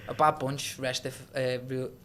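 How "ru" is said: ordinary talk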